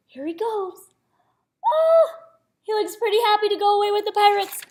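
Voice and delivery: gasping high delight; excited gasp. Real, not acted. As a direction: marvel at the picture. Reader gasps at the picture.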